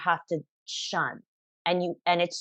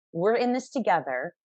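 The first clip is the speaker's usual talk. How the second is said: ordinary delivery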